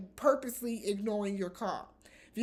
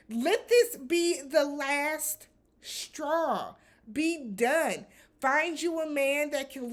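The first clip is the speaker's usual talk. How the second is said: very slow, louder, each word set apart; slow emphatic plea; 4 semitones higher, 4 dB louder, slower than usual. Real, not acted. Host urging Sheree to quit him, very slow. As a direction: lay it down word by word.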